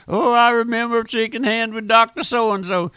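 high, loud mimicry of a boastful admirer; mock someone gushing in a high voice; gushing church member. Mimics people proud of Doctor So-and-so.

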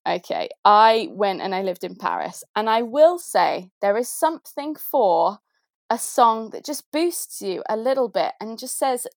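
usual speaking voice

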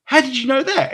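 high, loud astonished question; amazed. Retold or imitated. Asking the Doc Brown actor, high amazed voice. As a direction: gasp it out in disbelief. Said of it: "I was like".

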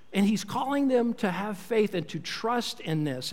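ordinary talk

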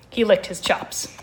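ordinary delivery

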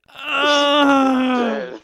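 long, loud, high howl; drawn-out hammed-up scream; mock pained wail. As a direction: imitate someone wailing in pain. Imitating hurt friend's cry.

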